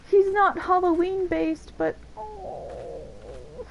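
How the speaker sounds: ordinary talk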